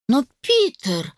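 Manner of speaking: slow whiny protest; whiny spoiled pouty drawl. A narrator's reading. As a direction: pout and drag it out. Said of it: "капризно надула губы Лика".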